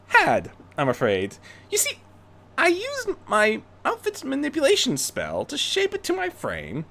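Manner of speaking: Rarity, smug. Acted. Rarity telling Bruce she outwitted his safeguard, smug. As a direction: gently gloat.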